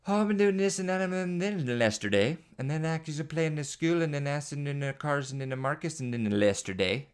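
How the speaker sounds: put-on high toddler babble with "lesterday"; imitating toddler rambling. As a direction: ramble like a toddler stringing "and then". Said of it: "Everything is yesterday or lesterday".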